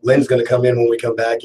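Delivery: usual speaking voice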